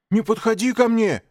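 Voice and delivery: high, loud frightened shout. Acted. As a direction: yell to keep him away. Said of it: "выкрикнул Маугли".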